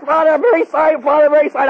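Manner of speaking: high, loud, panicky "Father, I'm very sorry"; frightened kid pleading. Retold or imitated. Kid cowering before yelling priest.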